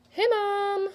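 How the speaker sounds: put-on high, bright call of a carefree kid; cheery child greeting. Little girl calling out to her mom, high and cheery.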